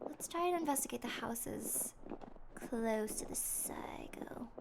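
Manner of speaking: slow hushed whisper. Real, not acted. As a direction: whisper it like sneaking up. Sneaking toward psycho's house.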